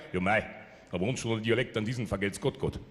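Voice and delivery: tiefes, gemütliches Bairisch; Münchner Charme nachmachen. Retold or imitated. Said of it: "Der Münchner in seinem ihm eigenen Charme". (Münchner dankt Gott tief auf Bairisch)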